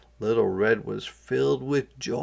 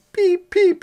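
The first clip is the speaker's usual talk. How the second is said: very high, loud chick peep; frightened peep; 6 dB louder than usual. A narrator's reading. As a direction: peep in fright as the hawk comes. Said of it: "he cried".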